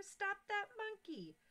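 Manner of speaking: high, slow shouted call; keeper shouting. Acted. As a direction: call out to catch the monkey. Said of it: "shouted the keeper".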